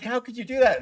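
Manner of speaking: high, loud imitated disbelief; mock aghast. Other actors questioning his joke on the captain, mock aghast. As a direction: reproach him in a high, loud voice.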